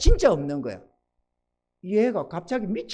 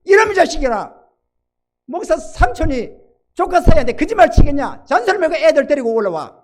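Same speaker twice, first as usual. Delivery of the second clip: louder, higher scolding rebuke; scolding roar; 6 semitones higher, 8 dB louder than usual. Retold or imitated. Pastor uncle thundering at his nephew over the phone. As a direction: tell the nephew off, no nonsense. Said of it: "내가 막 호통을 쳤지".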